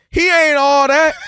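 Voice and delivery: high, loud, slow put-down; dismissive shout, dragged out; mock hater shout. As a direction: yell it like a fan turning on him. Mocking the fickle fans.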